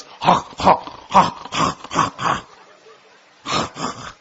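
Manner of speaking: voiceless monkey hooting pants; harsh puffed monkey calls; monkey noises. Acted. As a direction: huff like an angry monkey, all breath. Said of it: "No, the monkey goes".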